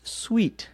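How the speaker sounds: suite said as sweet; pronounced sweet. Shown as a demonstration. Teacher answering how to say suite. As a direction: say sweet, rhyming with feet. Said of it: "how to pronounce the word that is spelled S U I T E".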